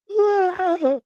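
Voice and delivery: high, loud mock scream of pain; imitating a scream. Man mimicking his sick date, loud mock scream. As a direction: yelp it like she's in agony.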